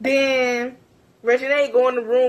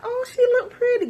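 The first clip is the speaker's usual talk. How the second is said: quoted in a much higher voice; a prissy, high compliment; mock high voice; 13 semitones higher than usual. Shania interrupting with a compliment, mock high voice.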